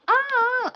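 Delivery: high, loud imitating fan shriek; hammed-up fan scream; mock fan scream. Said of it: "so they were like".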